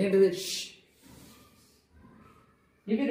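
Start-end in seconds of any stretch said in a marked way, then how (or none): 0.4-0.6 ష్ alone as a long hush; single sh sound. Shown as a demonstration. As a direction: hold ష్ as one continuous 'shhh', no vowel. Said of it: "ఒక్కటే sound వచ్చింది". ('ష్' ఒకే శబ్దంగా సాగదీసి)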